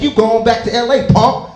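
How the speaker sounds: loud taunting little brother; mock taunt. Little brother taunting him during the fight, loud jeer. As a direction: jeer it like a cocky younger brother. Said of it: "He like".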